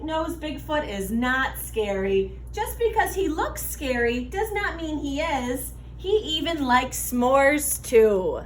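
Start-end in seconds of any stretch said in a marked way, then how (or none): none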